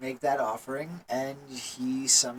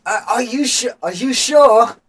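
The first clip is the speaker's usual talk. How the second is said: high, loud, nervous questioning; falter, then ask louder in high-pitched worry; alarmed; 9 semitones higher, 12 dB louder than usual. Acted. Acolyte doubts that the device is safe, loudly alarmed.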